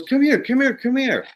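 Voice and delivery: urgent high calls; eager calling. Retold or imitated. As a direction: wave someone over excitedly.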